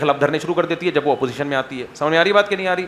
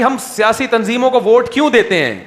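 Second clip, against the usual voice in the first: ordinary talk, nothing marked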